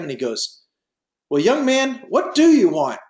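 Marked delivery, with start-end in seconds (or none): 1.3-3.0 high, pushy demand from a put-out father; a hint of an irritated rich dad pressing the young man; perturbed father. Billionaire father confronting the suitor who turned down both offers, a touch irritated. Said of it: "the father was looking really perturbed at him".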